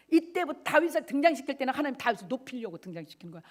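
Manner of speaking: ordinary delivery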